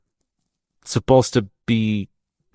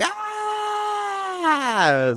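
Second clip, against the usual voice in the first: high mock creature scream; Yeti scream; 5 dB quieter than usual. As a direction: howl like a Yeti. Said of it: "they're just in the woods going".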